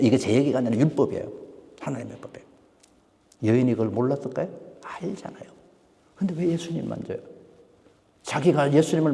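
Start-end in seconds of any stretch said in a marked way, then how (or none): 1.8-2.5 soft unvoiced whisper. Real, not acted. Whispered emphasis. 4.8-7.2 soft whispered aside. Real, not acted.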